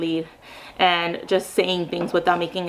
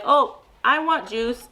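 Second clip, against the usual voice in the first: slow modeling; 4 semitones higher, slower than usual. Shown as a demonstration. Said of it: "and I'll be like".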